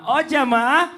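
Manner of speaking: loud, high call to the audience; rally the crowd, stretching jamaah up. Acted. Host calls to the audience. (seruan lantang ke jamaah)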